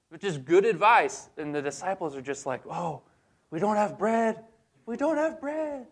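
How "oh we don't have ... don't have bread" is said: high whiny mock dismay climbing higher; dismayed disciple. Disciples fretting they have no bread, high whiny mock dismay. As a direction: wail it like a clueless disciple.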